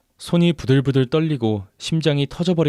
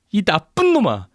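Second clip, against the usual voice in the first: high-pitched, furious curse; enraged; 9 semitones higher than usual. A narrator's reading. Betrayed wife cursing her husband, enraged. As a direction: hurl the insult in rage. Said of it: "원망과 분노로 제정신이 아니었습니다".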